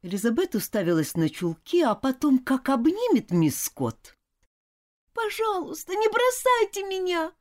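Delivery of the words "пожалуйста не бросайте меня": high wailing plea. A narrator's reading. Girl begs her governess not to leave, wailing. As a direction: lament and cling. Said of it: "запричитала она".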